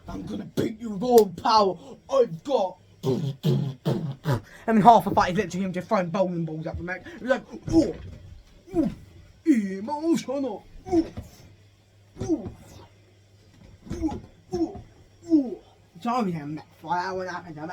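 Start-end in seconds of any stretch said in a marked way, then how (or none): 0.1-2.8 loud, higher battle cry; hammed-up villain threat; machinist villain. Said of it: "the machinist guy's like". 7.7-15.6 short high "ooh" bursts; cartoon fight yelps; imitated effort grunts. Imitates throwing bowling balls in fight.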